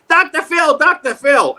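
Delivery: high-pitched fan calling out; mock excited call; excited passer-by.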